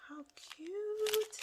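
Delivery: high, cooing delight; high-pitched cooing at a gift. Real, not acted. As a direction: coo over the cute item. Unboxing gifts, gushing over item.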